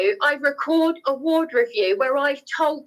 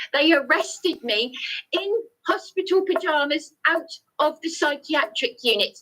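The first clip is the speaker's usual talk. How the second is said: usual speaking voice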